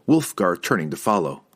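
ordinary delivery, nothing marked

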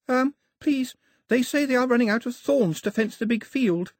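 put-on very high, hesitant child's voice; nervous little messenger, pitched high. A narrator's reading. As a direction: pipe up timidly like a small child interrupting. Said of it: "said the child nervously".

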